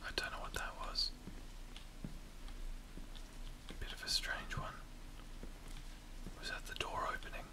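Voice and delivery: very soft, voiceless whisper. Real, not acted. Gamer creeping through a spooky house, whispering. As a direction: whisper warily, keep the sound down. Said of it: "Why am I whispering so much".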